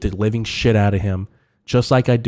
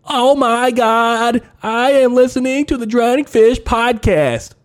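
much higher and louder, slow rapturous cry; ecstatic churchgoer; 13 semitones higher, 5 dB louder, slower than usual. Shown as a demonstration. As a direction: shout it out like a revival convert. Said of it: "Just like that".

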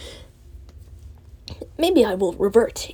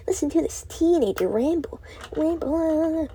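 showing off own voice; 7 semitones higher than usual. Shown as a demonstration. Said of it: "I don't like my fucking voice".

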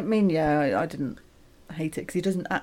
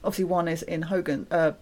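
ordinary delivery

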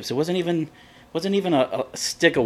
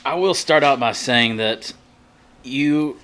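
ordinary talk

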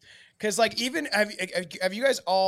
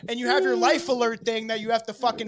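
mock scolding; 5 semitones higher, 6 dB louder than usual. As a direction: scold him like he failed. Mock scolding of a fallen man.

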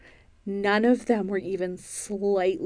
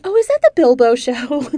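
higher, much louder mock mom; imitating mom; 5 semitones higher, 9 dB louder than usual. Mom asking about her child's ghost show, imitated loud and high. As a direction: voice mom's loud, high-pitched question. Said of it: "She's like".

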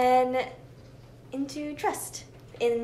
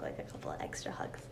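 soft whisper; 12 dB quieter, faster than usual. Real, not acted. Tender aside about hugging dogs.

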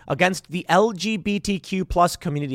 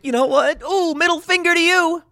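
high, loud mock-flippant quote; mocking retort; 13 semitones higher, 3 dB louder than usual. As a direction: taunt in a high, exaggerated voice. Mockingly voices AOC's dismissive attitude.

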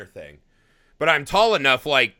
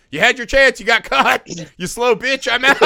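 loud, higher dismissive taunt; mocking taunt; 7 semitones higher, 5 dB louder than usual. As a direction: brush them off as you flee. Acted taunt to a kid.

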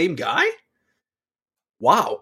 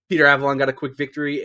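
ordinary delivery

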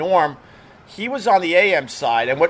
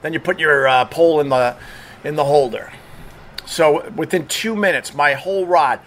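ordinary delivery

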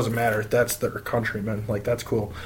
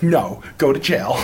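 slow, deadpan comic delivery; lightly voiced comedian verdict; a hint of Mulaney; John Mulaney impression; 3 dB louder, slower than usual. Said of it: "he sounded like John Mulaney".

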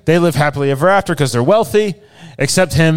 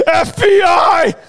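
long, drawn-out, much higher shout; agent shouting; 14 semitones higher, 4 dB louder, much slower than usual. Retold or imitated. Agent shouting down a pipe to the buried girl, drawn-out high yell. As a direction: bellow it slowly down the pipe. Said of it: "the dude's shouting through the P V C pipe".

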